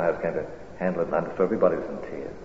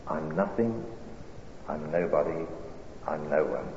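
poem recitation; 4 dB quieter than usual. Acted. Preacher reciting a poem to the congregation, hushed. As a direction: let each line settle before the next.

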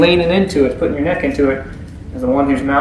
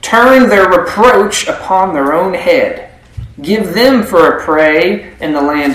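slow, raised pleading prayer to God; earnest prayer; 3 semitones higher, 4 dB louder, slower than usual. Acted. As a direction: cry out to God, drawn out and intense. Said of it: "Nehemiah prays".

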